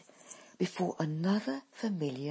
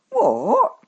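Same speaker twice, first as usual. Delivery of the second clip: surprised daughter; 10 semitones higher, 14 dB louder than usual. A narrator's reading. Dolly reacts, surprised.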